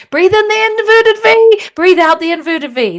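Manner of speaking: high, loud overexcited cueing; too pumped for subtle breath; wrong energetic tone. Shown as a demonstration. Teacher shows the wrong voice for inverted V, high and loud. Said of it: "That's not gonna work".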